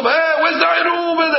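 acted husband calling out, pitched high; high, drawn-out holler through the house. Husband coming home demands of his wife, calling out high. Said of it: "the neighbors shouldn't hear it".

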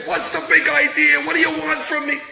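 loud, indignant questioning; angry confrontation. Retold or imitated. Yaakov shouting back at Lavan, mock angry. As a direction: demand answers, arms waving. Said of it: "he was waving his hands".

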